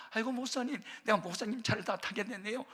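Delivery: high-pitched delighted gushing; imitating delight. Church member offered a lift by the pastor, imitated high-pitched delight. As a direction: act out a thrilled, flustered thank-you. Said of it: "그래서 막 좋아하는 거예요".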